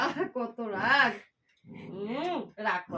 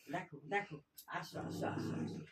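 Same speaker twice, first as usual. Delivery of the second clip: soft whispered calling, softer; whispered coaxing; 7 semitones lower, 13 dB quieter than usual. Real, not acted. Owner whispering to coax her angry pet near. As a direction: whisper gently to lure the pet closer.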